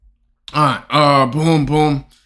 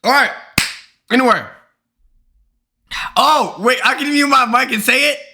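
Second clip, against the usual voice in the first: 1 excited; 8 semitones higher than usual. Real, not acted.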